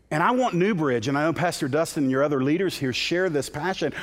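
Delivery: usual speaking voice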